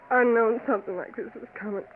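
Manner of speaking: usual speaking voice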